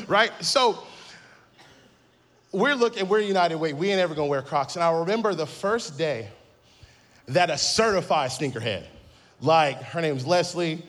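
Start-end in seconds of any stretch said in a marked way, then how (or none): none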